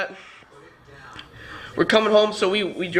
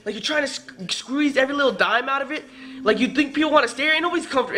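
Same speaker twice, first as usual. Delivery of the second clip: high-pitched incredulous rant; imitated exasperated tirade; retold going off; 6 semitones higher than usual. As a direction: replay yourself complaining indignantly. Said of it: "started going off a little bit".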